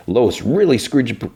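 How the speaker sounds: ordinary delivery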